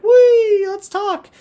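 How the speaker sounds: very high squealed "whee" voice; magical sprite. Retold or imitated. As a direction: squeal like a silly flying sprite. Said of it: "and it's like".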